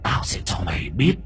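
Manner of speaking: whispered ghostly threat; sinister whispered warning; menacing whisper. Acted. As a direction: hiss it low under the breath as a threat.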